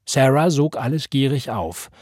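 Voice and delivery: plain reading voice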